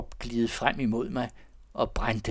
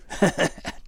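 bitter laugh; 7 semitones higher, 4 dB louder than usual. A narrator's reading. Wild laugh at himself.

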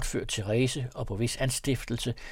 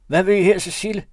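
higher, louder question from inside; calling out; 7 semitones higher, 8 dB louder, faster than usual. A narrator's reading. Voice inside asking the woman, loud. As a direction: call out suspiciously. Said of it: "spurgte stemmen".

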